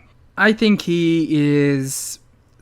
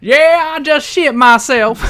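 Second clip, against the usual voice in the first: much higher, louder mock drawl; Dixie Carter impression; 8 semitones higher, 4 dB louder, slower than usual. Joking about the tight outfit as Dixie Carter, high mock voice. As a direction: put on a cartoon Southern exec voice. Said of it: "I got a little Dixie then".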